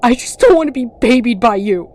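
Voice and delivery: loud yell. Acted. Hazel yelling at her brother up close, loud yell. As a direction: shout it right in his face. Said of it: "She began to yell".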